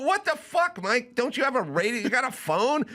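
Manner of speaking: high-pitched mock outrage; exasperated scolding. Comic scolds his friend for missing the news, exasperated. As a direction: berate him in disbelief, voice up high.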